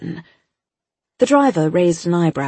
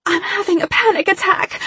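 much higher, tearful voice; through tears. A narrator's reading. Girl pleading with the agent, high tearful voice. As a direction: fake a breakdown to save yourself. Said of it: "I said through the tears".

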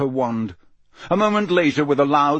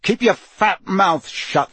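higher, louder, slow angry put-down; angry retort; 8 semitones higher, 5 dB louder, slower than usual. Acted. Harry turning away from Potter's taunter, angry retort. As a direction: push each word out slowly and angrily.

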